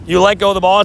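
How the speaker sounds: ordinary delivery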